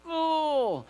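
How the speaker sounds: sudden high surprised "Oh"; a jolt of realization, drawn up high. Retold or imitated. His realization voiced.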